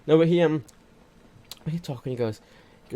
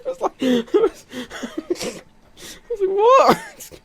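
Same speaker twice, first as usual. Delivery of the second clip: 1 laughing shock; 4 dB louder, much slower than usual. Retold or imitated. Retelling own shock, laughing.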